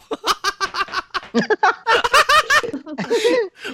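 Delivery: high-pitched, long burst of laughter; big laugh. Real, not acted. Host cracks up at the hotel parking story, big high laugh. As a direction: laugh out loud, high and helpless.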